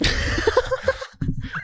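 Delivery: high, breathless burst of laughter. Real, not acted. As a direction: crack up at your own joke. Cracking up at own joke.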